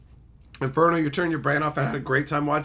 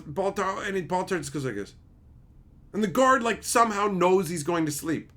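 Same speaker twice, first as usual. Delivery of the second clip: ordinary delivery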